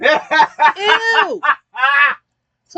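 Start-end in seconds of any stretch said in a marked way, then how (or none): 0.0-1.4 high, loud grossed-out ew; disgusted. Real, not acted. Reacting to the Coke bottle.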